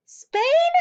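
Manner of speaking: very high, loud outraged cry; can't believe it; shocked cry. A narrator's reading. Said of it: "Matilda cried".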